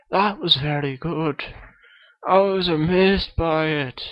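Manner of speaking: a kid's hammed-up Scottish accent; Billy Connolly impression. Guest Billy Connolly greets the podcast host, mock Scottish accent. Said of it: "Billy Connolly is on the show".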